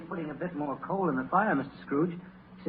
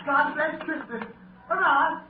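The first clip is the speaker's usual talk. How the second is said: high, loud hurrah; cheer; 11 semitones higher, 5 dB louder than usual. Acted. Clerk cheering Christmas from his desk. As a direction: burst out cheering.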